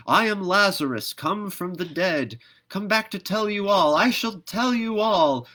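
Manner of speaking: high, loud proclamation; loud declaiming. Acted. Lazarus back from the dead, proclaiming to everyone: loud, high declamation. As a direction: ring it out like a prophet returned.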